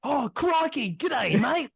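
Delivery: hammed-up broad Australian accent, pitched high; Steve Irwin impression. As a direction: play the broad Australian croc hunter. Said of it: "your stereotypical Steve Irwin".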